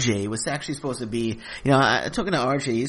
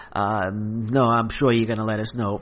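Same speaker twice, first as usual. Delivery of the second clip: ordinary talk, nothing marked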